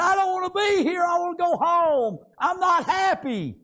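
put-on high, loud plea of an unhappy old woman; preacher voicing a sad lady's lament. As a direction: cry out her wish to go home. Preacher voices sad old lady.

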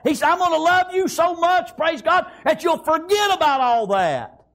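high, driving revival preacher; preaching at full force. Real, not acted. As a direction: pile up God's promises with mounting fervor, pitched high. Preaches God's promise at full force.

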